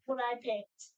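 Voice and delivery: ordinary delivery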